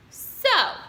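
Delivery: a loud disgusted So. A narrator's reading. Rat scoffing at Wilbur's plea, loud and disgusted. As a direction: scoff at the request. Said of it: "he said in disgust".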